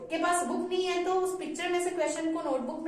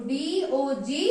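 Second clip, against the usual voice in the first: slow spelling; 3 semitones lower, much slower than usual. Shown as a demonstration. Teacher spelling the answer for pupils, very slow. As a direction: spell DOG slowly, letter by letter, ending on a rise. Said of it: "ऐसे आपको ओ fill करना है".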